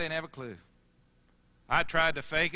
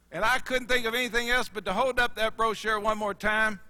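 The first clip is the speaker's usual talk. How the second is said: ordinary talk, nothing marked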